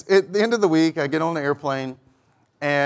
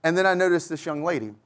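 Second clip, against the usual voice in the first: ordinary delivery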